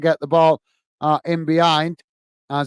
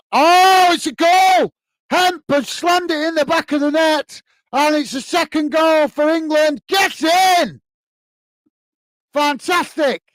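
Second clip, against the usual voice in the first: goal celebration; 10 semitones higher than usual. Real, not acted. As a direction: erupt as the ball hits the net. Live goal commentary, goal scored.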